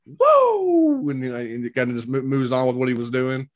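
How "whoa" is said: sudden high yelp of surprise; a hammed-up startled whoa; mock whoa. Retold startled passerby reaction.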